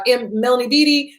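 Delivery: normal talking voice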